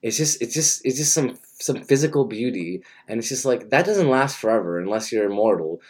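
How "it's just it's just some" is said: normal talking voice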